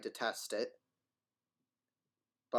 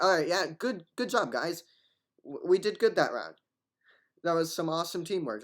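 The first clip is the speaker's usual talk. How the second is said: higher, louder mock congratulations; upbeat team praise; 6 semitones higher, 5 dB louder than usual. Player imagining himself congratulating teammates, upbeat praise. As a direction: cheer on your squad after a round.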